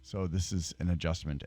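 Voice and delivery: normal talking voice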